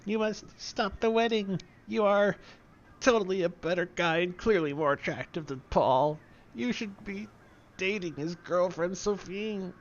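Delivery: high, fading, hammed-up dying voice; a melodramatic last speech; dying last words. Dying Slavo urging Bruce to stop the wedding, high and fading.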